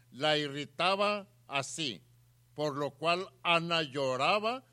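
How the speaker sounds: emphatic, projected Bible reading; forceful scripture reading. A narrator's reading. Preacher reading Elcana's story to the congregation, forceful scripture reading. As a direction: read the verse out with rising force.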